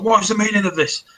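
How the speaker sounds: high, quick captain's demand; indignant captain. Acted. Captain confronting the attackers on deck, high indignant demand. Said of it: "She's like".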